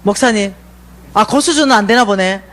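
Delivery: usual speaking voice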